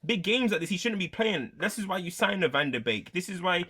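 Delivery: normal talking voice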